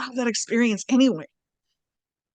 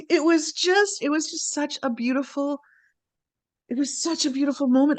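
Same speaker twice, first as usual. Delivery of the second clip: higher, choked-up wonder; real tearful emotion; 5 semitones higher than usual. Real, not acted. Recalling such a beautiful moment, tearful and moved. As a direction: talk through welling tears. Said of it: "I'm crying about it now".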